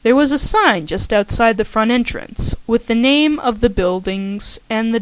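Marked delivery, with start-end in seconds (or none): none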